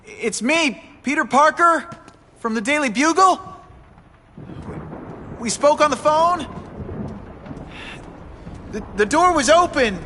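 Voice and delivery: high, tense and hesitant; nervous. Acted. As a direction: speak up nervously, pitched high. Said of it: "Don't be nervous".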